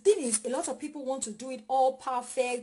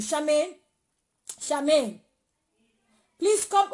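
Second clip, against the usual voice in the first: raised voice calling a name across the house; loud call to someone offstage; 3 semitones higher, 7 dB louder, slower than usual. Real, not acted. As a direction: call her from the next room, loud and high. Calling someone from another room.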